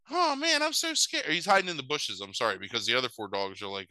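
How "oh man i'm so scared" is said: much higher, mock-scared kid voice; scared puppy. B-Dog the puppy facing the haunted house, mock scared. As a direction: whine it up high like a little puppy. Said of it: "B-Dog is like".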